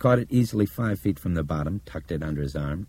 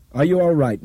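called out higher and louder; calling out; 11 semitones higher, 7 dB louder than usual. Acted. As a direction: shout the question up to her. Man shouts up from the slide.

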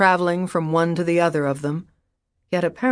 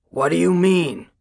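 rough demand. Acted. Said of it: "he demanded roughly".

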